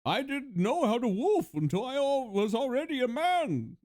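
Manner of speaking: mask-muffled menacing villain speech; Bane impression. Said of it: "he would sound like this".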